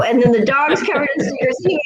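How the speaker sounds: normal talking voice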